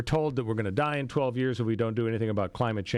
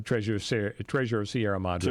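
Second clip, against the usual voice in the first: ordinary talk, nothing marked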